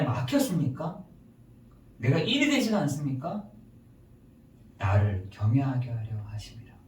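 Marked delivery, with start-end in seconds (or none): 4.8-6.7 low, hushed quotation; God's answer. Acted. God's answer to troubled listeners, low hushed answer. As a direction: drop the voice into a hushed divine answer. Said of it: "양심의 말하기를 뭐라고 하는지".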